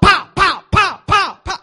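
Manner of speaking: put-on loud, high pow pow like a kid shooting; kid gun noises. Shown as a demonstration. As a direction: shout the pows like a boy playing cops. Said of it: "He's shooting them all up".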